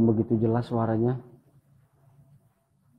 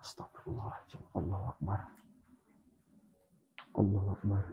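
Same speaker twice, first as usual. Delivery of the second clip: breathless whispered prayer, barely there; frightened whisper; 4 semitones lower, 15 dB quieter than usual. Real, not acted. Ghost hunter hearing bathing spirit, scared whisper.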